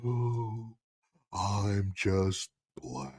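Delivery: low, very slow mock-gloomy moan; Eeyore. Mocking those under a dark cloud, gloomy Eeyore voice. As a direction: drag it out softly, hammed-up misery with heavy sighs. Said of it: "just like Eeyore".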